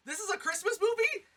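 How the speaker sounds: high, loud astonished question; hammed-up scandalized delight; mock disbelief. Retelling shock at the screenshot.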